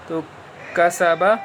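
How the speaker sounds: ordinary talk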